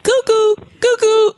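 high cuckoo bird call. Pretend. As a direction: chirp it like a cuckoo clock. Sound effect she makes up.

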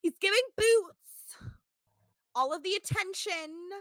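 high, loud theatrical diva impression; New York theatrics voice. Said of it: "doing full on New York theatrics voice".

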